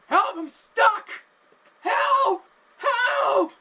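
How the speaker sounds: very high, full-force screams for help; panicked screaming. Retold or imitated. Man with a finger stuck in an ear, panicked screaming. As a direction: scream as if trapped. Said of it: "he's just screaming, wailing in pain".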